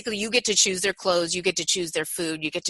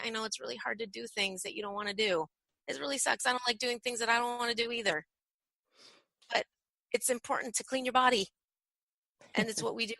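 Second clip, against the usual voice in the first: gentle reassurance; 3 semitones higher, 8 dB quieter than usual. Shown as a demonstration. Soothing child before bath.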